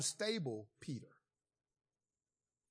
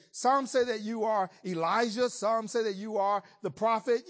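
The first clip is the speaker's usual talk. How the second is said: louder, higher eager chorus of guesses; eager disciples; 4 semitones higher, 6 dB louder than usual. Retold or imitated. Disciples eagerly telling Jesus who people say he is, louder and higher. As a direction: toss out the answers with eager excitement.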